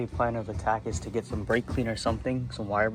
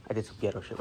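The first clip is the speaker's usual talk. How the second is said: ordinary talk, nothing marked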